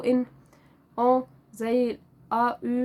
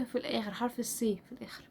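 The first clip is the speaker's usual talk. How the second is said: whisper; 10 dB quieter than usual. Real, not acted. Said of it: "whispered aside".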